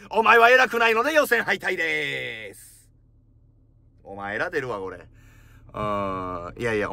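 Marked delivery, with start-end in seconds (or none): 0.1-2.9 raised, slow drawn-out ruling; mock judge verdict. Comedian eliminating the rude receptionist, slow mock verdict.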